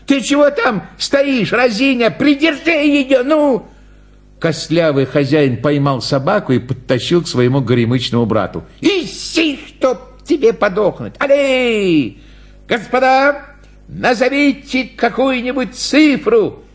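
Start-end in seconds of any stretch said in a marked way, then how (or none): 0.0-3.6 high-pitched exasperated scolding; angry clown shouting. A narrator's reading. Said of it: "закричал клоун". 8.8-12.2 high-pitched cursing clown; exasperated command. Acted. Clown curses and commands dog. 12.7-16.6 high announcing clown voice; showman's call. Acted. Clown calls to the audience.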